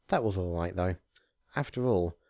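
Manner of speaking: usual speaking voice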